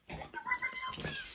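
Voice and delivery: extremely high, squeaky delight; overwhelmed squeal. Real, not acted. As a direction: repeat it as a tiny squeal. Repeats it overcome with delight.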